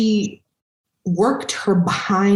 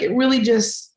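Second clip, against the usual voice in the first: normal talking voice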